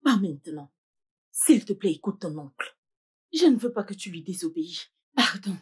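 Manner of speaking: ordinary delivery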